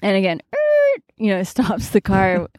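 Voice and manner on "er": high squeal of screeching brakes; imitated brakes. Telling of the truck halting, a high screech. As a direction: mimic the truck stopping.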